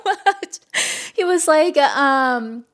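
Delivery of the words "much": slow, high, choking up with love; teary laughing confession; tearful. Real, not acted. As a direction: say it as tears start. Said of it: "why am I gonna cry".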